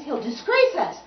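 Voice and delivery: acted annoyed outburst; snapped. A narrator's reading. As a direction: snap it like an offended player. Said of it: "snapped the violins".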